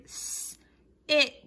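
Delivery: "s, it" split into a hissed S, pause, then short I; isolated "s", then a crisp "it"; sounding out short I. Shown as a demonstration. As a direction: sound out "s, it" in separate parts. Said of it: "short I words like in".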